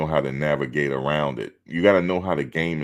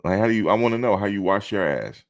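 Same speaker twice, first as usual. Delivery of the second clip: sassy comeback; 6 semitones higher than usual. Retold or imitated. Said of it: "I said".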